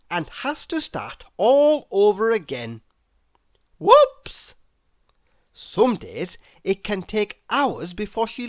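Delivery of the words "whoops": high, loud squeal of whoops; squeaky whoops. A narrator's reading. Narrator squeals when eggs drop. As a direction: squeal it like a sudden slip. Sound-effect exclamation after accident.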